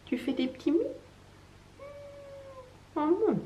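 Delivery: soft, high cooing to a cat. Real, not acted. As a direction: coo gently at the pet. Parle tendrement à son chat. (voix douce pour le chat)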